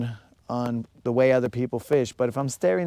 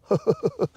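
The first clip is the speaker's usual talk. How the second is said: high, quick giggle; chuckling; 8 semitones higher than usual. Real, not acted. Angler to Creel over the joke, chuckling. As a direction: let out a rapid string of chuckles.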